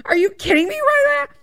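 high-pitched incredulous squeal; outraged disbelief. Retold or imitated. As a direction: shriek it in disbelief. Outraged retort to agent.

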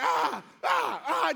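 repeated wordless argument yells; imitated back-and-forth hollering; volley of hammed-up yells; mock yelling. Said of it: "just yelling".

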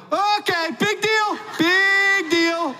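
father yelling on seeing his son's toe, alarmed shout. Retold or imitated. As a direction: suddenly shout it out in panic. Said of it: "I snapped into big deal mode".

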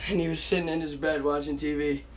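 ordinary talk

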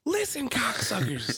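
hammed-up TV host rant; John Taffer yelling. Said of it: "You sound like, uh, the parrot from".

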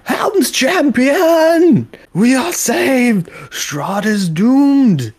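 high, slow, ecstatic crowd chant; crowd hailing hero. Acted. Villagers hail Helm's champion, ecstatic and loud. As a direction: rejoice like saved villagers.

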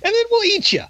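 mocking rock creatures. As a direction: tease the little wimp in a high creature voice. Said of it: "the rock creatures".

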